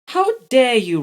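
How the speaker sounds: high, loud outrage; indignant. A narrator's reading. Ella scolding Robbie, indignant. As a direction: scold him hard. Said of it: "she said".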